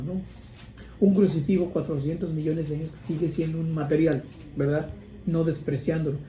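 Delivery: ordinary delivery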